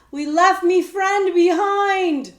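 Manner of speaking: loud, high and slow shout. A narrator's reading. Boy shouting to the coachman about his friend, loud and slow. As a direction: call it out urgently. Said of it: "Jemmy shouted".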